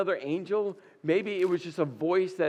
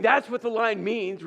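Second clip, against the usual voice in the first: ordinary talk, nothing marked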